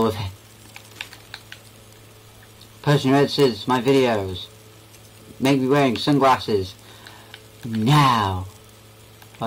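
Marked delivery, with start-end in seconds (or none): none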